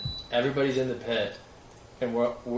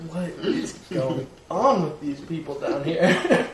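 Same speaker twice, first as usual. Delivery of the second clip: slow, higher, louder mock bewilderment; baffled gorilla; 5 semitones higher, 4 dB louder, slower than usual. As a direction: puzzle it out slowly, laughing through it. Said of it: "looked like a gorilla trying to understand".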